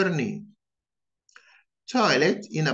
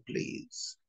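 ordinary talk

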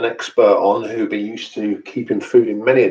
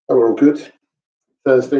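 usual speaking voice

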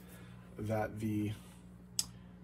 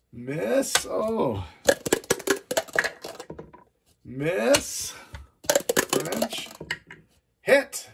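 building to a shout on the final hit; rising higher and louder with each roll until the big hit; excited; 9 semitones higher, 8 dB louder, much slower than usual. Real, not acted. Tense dice duel reaching its climax.

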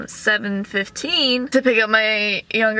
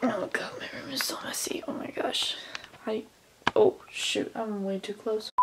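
whisper; 12 dB quieter than usual. Real, not acted. Messy room, quiet whisper.

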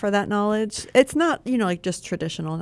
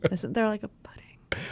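hushed aside, whispered; 2 semitones higher, 8 dB quieter than usual. Real, not acted. Whispering a lore question to cohost.

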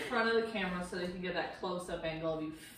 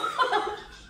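unvoiced giggle; 4 dB louder than usual. Real, not acted. Friends joking while cooking.

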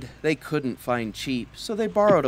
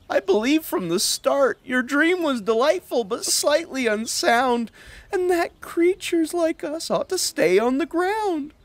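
voicing Hop; 10 semitones higher than usual. Hop's voice.

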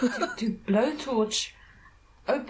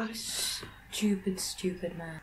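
whisper; 4 semitones lower, 9 dB quieter than usual. Real, not acted. Disbelief at the idiot criminal.

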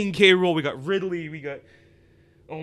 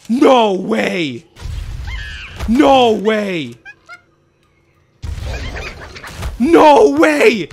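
each "No way!" louder and higher, from a loud shout up to a scream; disbelieving shout; 3 semitones higher, 8 dB louder, much slower than usual. Real, not acted. Fan reacting to the reveal, real shouts building to a scream. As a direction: let the disbelief keep climbing into a real scream.

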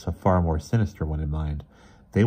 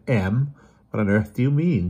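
mildly bewildered, raised pitch; 5 semitones higher, 4 dB louder than usual. A narrator's reading. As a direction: question them in lightly acted bewilderment. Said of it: "Miss Eels looked utterly bewildered".

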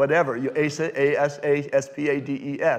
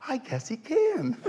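amazed concession; 12 semitones higher, slower than usual. Retold or imitated. Contestant concedes after the trick.

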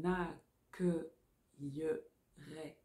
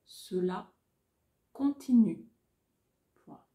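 dictating; 7 dB louder than usual. Shown as a demonstration.

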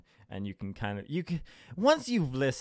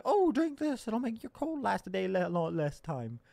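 high, softening put-on voice; mock remedy pusher; 8 semitones higher, 3 dB quieter than usual. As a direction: parody someone offering a herbal cure. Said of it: "and we're like".

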